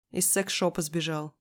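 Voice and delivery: ordinary delivery, nothing marked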